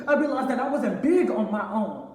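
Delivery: loud, rising emphatic confession; anguished outburst. Acted. Son confessing to Pop how the lie hurt, anguished outburst. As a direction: build in force, drawing out the final words. Confronting Pop with painful realization.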